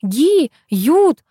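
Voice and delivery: high mock cry of clipped word endings; high-pitched imitation of broken shouting; imitated yelling. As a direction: mimic a garbled phone yell. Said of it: "Ты ещё орала".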